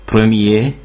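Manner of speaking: premier ending on an "ay" vowel, R silent; French premier, no final R. Shown as a demonstration. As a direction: leave off the last R in premier. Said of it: "csak akkor ejtjük ki".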